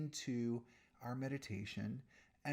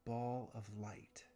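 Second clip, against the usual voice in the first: guided meditation. Real, not acted. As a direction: guide them unhurriedly through each breath, softening at phrase ends.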